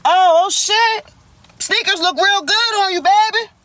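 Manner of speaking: taunting an ex with fake praise; petty mock flattery. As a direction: drag it out, pretend-sweet and petty. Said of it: "So I'm a petty bitch".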